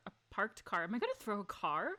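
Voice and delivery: ordinary delivery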